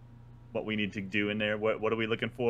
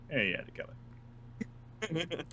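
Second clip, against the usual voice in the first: in-character quip; 6 semitones higher, 7 dB quieter, much slower than usual. Acted. Player voices character to researcher.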